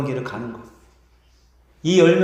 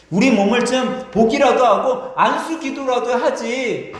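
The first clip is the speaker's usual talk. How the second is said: higher-pitched, mildly hammed-up grumble; aggrieved complaint; 6 semitones higher, 3 dB louder than usual. Leper grumbling that Jesus did not come near, acted complaint. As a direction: protest with raised pitch, feeling let down.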